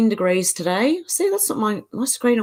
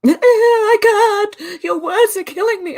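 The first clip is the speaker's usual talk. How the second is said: melodramatic; 14 semitones higher, 5 dB louder than usual. Pretend. Mock dramatic reaction.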